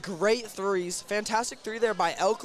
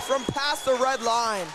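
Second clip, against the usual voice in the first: thrilled call of a buzzer-beater, pitched up; real sportscaster excitement, higher and stretched out; excited play-by-play; 4 semitones higher, slower than usual. Real, not acted. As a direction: build to a big drawn-out shout as the shot drops. Calls a buzzer-beating three.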